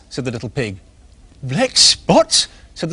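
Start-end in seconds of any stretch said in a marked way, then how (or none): 1.4-2.5 surprised squirrel. Acted. Nosy squirrel asking.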